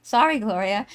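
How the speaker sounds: put-on a light Scottish accent, rising at the end; lightly repeated in a Scottish accent; a hint of a Scottish brush-off with a lift. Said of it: "in a Scottish accent".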